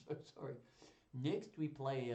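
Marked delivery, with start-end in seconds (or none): 1.1-2.1 slow, hammed-up French accent. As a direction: recite grandly in a shaky attempt at French accent. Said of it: "shall I try a French accent?".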